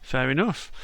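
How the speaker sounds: ordinary delivery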